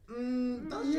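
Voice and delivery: high-pitched, drawn-out doubt; long dubious hum into a squeaky question; scettica. Real, not acted. Doubts the actor's accent.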